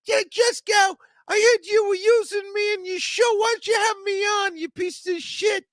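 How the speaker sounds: very high-pitched squeaky little man yelling; shrill puppet-like voice shouting insults; Mario impression. Imitating Mario demanding to be on the show, high and loud. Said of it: "That's the way he talks".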